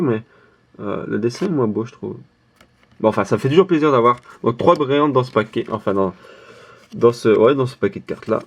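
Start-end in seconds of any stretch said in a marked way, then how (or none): none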